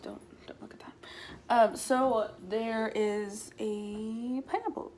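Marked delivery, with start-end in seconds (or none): 0.0-0.9 whispering. Real, not acted. Embarrassed about closed closets.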